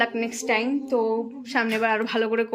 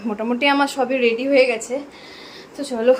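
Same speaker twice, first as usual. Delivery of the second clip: usual speaking voice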